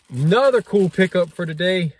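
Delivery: loud, high mock excitement; slow, overblown showcase with sarcastic awe; sarcastic hype. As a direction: present cheap party supplies like treasures. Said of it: "The sarcasm, I hope, is not lost".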